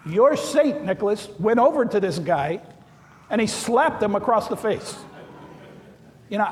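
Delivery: ordinary delivery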